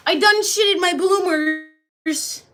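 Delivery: loud, high, drawn-out mock confession; hammed-up, dragged-out outcry. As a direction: wail it slowly in hammed-up dismay. Repeats the silly confession, bigger.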